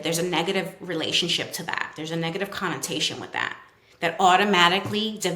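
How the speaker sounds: normal talking voice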